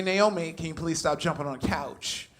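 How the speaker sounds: normal talking voice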